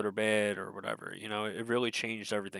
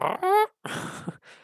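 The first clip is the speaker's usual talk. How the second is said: high trilling creature chirr; imitated creature noise; 5 dB louder than usual. Imitating Momo's sounds from the show, high creature trill. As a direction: mimic the animal's sound, high and rolling. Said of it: "He's going".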